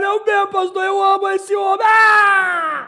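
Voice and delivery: loud, high hammed-up wail; mock tearful wail. Wife declares love for her husband, mock wailing. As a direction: bawl it out as a comically lovestruck wife. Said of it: "E ela chorando".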